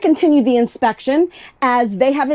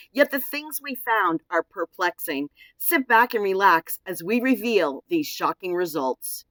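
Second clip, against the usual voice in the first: dramatic voiceover; 6 semitones lower, 7 dB quieter than usual. Acted.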